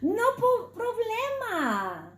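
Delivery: high, laid-back voice for the cool cat; cool cat Chato. Acted. Cat talking to himself, cool and easy. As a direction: play it smooth and pleased with himself. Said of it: "Chato said to himself".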